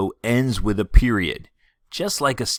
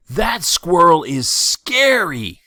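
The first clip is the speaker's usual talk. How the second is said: higher, louder, slow, hammed-up fright; mock fear; 9 semitones higher, 7 dB louder, much slower than usual. Shown as a demonstration. Teacher showing fear in an exclamation, hammed-up scared. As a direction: play it as scared of the squirrel. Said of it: "in this case, fear".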